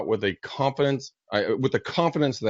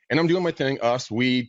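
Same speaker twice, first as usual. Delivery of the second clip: normal talking voice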